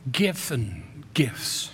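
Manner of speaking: slow whisper; slow unvoiced whisper fading out; whispered trailing off. Real, not acted. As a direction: breathe the words out. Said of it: "trails off to a whisper".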